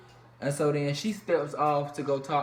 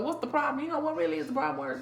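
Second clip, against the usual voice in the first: Angel; 9 semitones higher, faster than usual. Retold or imitated. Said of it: "I can't do a New Orleans accent".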